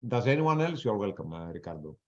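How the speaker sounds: ordinary talk, nothing marked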